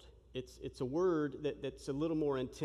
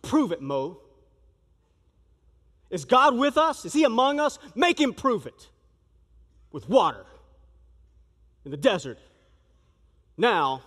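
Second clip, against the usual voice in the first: angry yelling crowd; 2 semitones higher, 11 dB louder than usual. Acted. Thirsty Israelites yell at Moses to prove God, furious shout. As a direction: shout them down like an angry mob. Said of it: "they yell".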